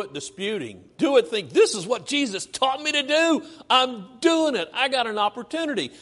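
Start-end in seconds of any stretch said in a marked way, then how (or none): 1.5-5.9 higher, bright earnest self-talk; cheerful believer. Pretend. Preacher voices a believer walking the second mile, eager. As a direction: sound glad of the chance. Voicing a faithful believer's thought.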